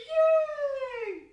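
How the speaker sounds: very high, very loud cheer; delighted squeal. Real, not acted. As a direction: shriek with glee.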